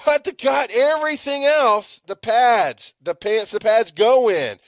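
loud, high, heated rant; angry rant. Real, not acted. Podcaster ranting about football fees, loud and heated. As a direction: rail at the football program.

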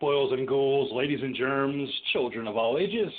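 stretched-out showman welcome; horror host. Acted.

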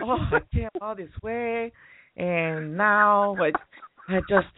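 high, slow, drawn-out mock whine; put-on high complaining voice, dragging between pauses; whining imitation. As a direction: imitate a whiny date who never finishes. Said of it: "your imitation is very scary".

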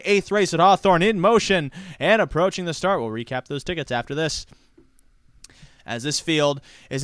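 fast, rising live race call; race caller. Real, not acted. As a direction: call the race building to the finish.

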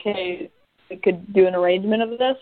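ordinary talk, nothing marked

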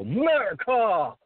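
retelling a proud yell at the school rally; loud shout. Retold or imitated. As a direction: belt out the word slowly and high. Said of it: "all loud".

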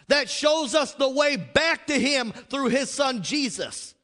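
high, loud, slow preacher's cry; fiery pulpit preaching; preaching at full force. Acted. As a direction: proclaim it to the whole church.

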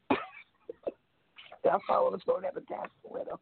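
words squeezed out through high breathless giggles; laughing at her own reading, barely getting the words out; giggling. Real, not acted. Host to co-host, giggling helplessly at her own poem. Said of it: "I love it when you tickle yourself. You're giggling".

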